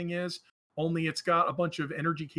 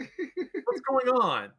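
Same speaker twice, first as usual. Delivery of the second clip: startled; 9 semitones higher, 4 dB louder, much slower than usual. Acted. Doctor startled by robot.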